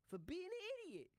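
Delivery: very high mock tough guy; gangster line. Retelling his own sketch line, very high. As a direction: deliver the insult in a high mob voice. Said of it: "And I go".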